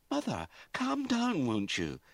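high, slow, anxious plea; pleading. A narrator's reading. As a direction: beg her mother to calm down. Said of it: "she pleaded".

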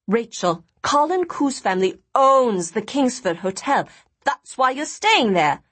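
excited. Acted. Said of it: "jabbing her arm excitedly".

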